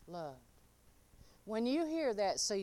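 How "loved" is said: soft answer. Shown as a demonstration.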